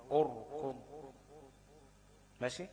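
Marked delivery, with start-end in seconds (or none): 0.1-0.8 أرقد said louder, with a thick r after the u; the teacher shows how to begin with أرقد; heavy r demo. Shown as a demonstration. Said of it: "فأقول إيه".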